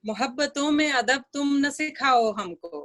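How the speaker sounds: measured, lilting verse reading; ghazal recitation. Acted. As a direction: recite the couplet for a mushaira audience.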